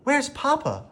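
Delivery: very high, frightened question; anxious. A narrator's reading. Belle begs the horse to tell her where Papa is, very high. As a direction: ask in rising panic. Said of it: "she cried".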